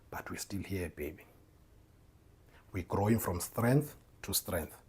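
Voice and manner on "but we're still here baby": zacht gefluisterd aan zijn vrouw; tender whisper. Real, not acted. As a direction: fluister het teder tegen je vrouw. Tender words to wife.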